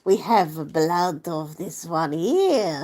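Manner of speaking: mock Russian accent, very slow; slow, hammy Russian-accented gnat. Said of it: "Russian voice".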